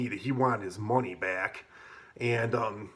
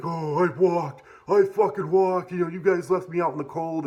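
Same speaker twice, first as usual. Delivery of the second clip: mock whiny excuse; 5 semitones higher, 3 dB louder than usual. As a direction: play the hard-done-by guy complaining. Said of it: "he's like".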